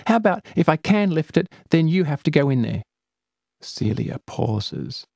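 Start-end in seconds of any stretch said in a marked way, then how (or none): 0.1-2.8 put-on quick girlish dare; bargaining girl. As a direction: strike a deal with a grin. Companion bargaining.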